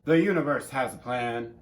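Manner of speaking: loud, high mock sermon with laughs; mock preacher. Friend mocking her karma speech as a loud sermon. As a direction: preach it back at her like a church revival.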